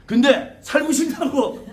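high, slow-stretched demand; insistent mock threat. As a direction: press the threat as a pig-headed spirit general. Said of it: "그랬더니".

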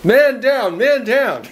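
high, urgent cry of alarm; alarmed call. Real, not acted. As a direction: call out for help, half joking. Just stung, calls out alarm.

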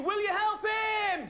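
high, slow shout to the whole room; calling out loud. Real, not acted. Host rallying the children to help Bernie, shouting. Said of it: "he can't hear you".

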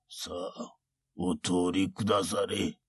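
put-on quiet, polite old monk; creaky wise old man. Monk dismisses samurai.

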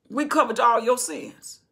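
ordinary talk

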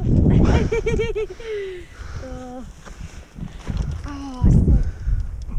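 long high burst of laughter; big laugh. Real, not acted. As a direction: crack up laughing. Laughing at own joke.

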